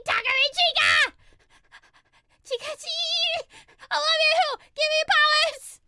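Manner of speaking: high, loud hammed-up fangirl begging; frantic mock prayer at full volume; desperate pleading. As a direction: wail to your idol for help, wildly overdramatic. Acts out begging idol figurine.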